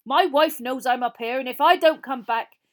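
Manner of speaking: high, loud, urgent bluff; loud defiant warning. A narrator's reading. Frank bluffing the killer about his wife, loud defiance. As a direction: warn him off at full voice.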